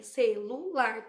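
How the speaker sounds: "Celular" in three slow beats, ce-lu-lar; syllable by syllable. Shown as a demonstration. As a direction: break "Celular" into separate pieces. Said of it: "Quantos pedacinhos tem?".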